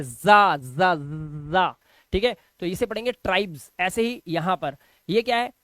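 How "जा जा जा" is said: जा as "za", repeated loud with a buzzing z; buzzed Z sound. Shown as a demonstration. Teacher drilling the nukta sound, loud buzzed z. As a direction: drill "za, za, zzza", drawing out the buzz. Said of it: "तो ये जा पढ़ा जाता है".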